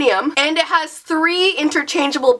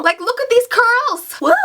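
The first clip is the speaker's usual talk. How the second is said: excited; 9 semitones higher than usual. Real, not acted. Showing off her new curls.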